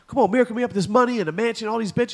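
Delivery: high, fast pleading bum voice; begging homeless guy. Retold or imitated. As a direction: wheedle for a miracle handout. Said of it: "the homeless guy is like".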